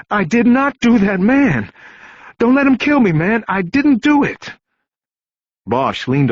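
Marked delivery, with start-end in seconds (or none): 0.1-4.5 high, loud, near-hysterical pleading; fear bordering on hysteria. Acted. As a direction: beg for your life, on the edge of panic. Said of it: "a voice now tinged with fear bordering on hysteria".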